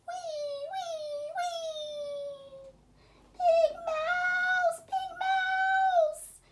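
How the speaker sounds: high, loud frightened mouse yelling for help; frightened Little Mouse. Acted. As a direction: shriek for Big Mouse, scared of the ghost.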